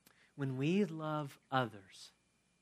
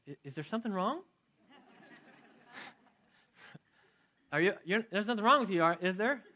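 higher, louder, alarmed mother asking if something is wrong; worried mom; 6 semitones higher, 4 dB louder than usual. Retold or imitated. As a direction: sound like a mom suspecting bad news.

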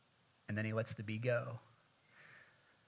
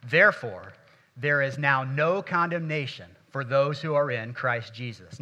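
congregation reading aloud; 9 dB louder than usual. Real, not acted. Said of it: "do that one more time".

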